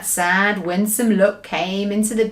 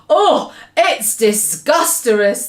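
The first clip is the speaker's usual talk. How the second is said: gurgling; 3 semitones higher, 5 dB louder, slower than usual. Acted. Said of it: "the B F G gurgled".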